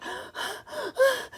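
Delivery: sharp high gasp for air; breathless gasp. Acted. Running pig arriving, breathless high gasp. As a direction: gasp like a pig that ran all the way. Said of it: "he gasped".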